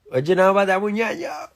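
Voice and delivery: very high, loud excited yell; hyped-up shout. Real, not acted. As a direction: shriek it with playful hype. Hyped up over a catchphrase.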